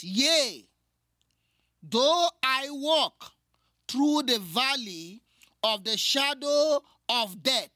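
solemn slow reading of the psalm; scripture reading. Acted. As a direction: draw out each phrase with gravity. Reads psalm aloud.

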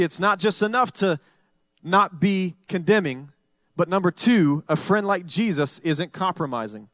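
usual speaking voice

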